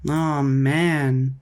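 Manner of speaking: disappointed kids' groan, put-on voice. A narrator's reading. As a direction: groan it like let-down kids.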